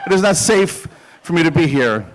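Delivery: ordinary talk, nothing marked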